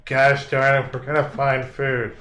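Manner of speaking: loud, gruff giant shushing; grumpy giant John. Acted. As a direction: bark it at a hungry friend. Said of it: "I think John's like".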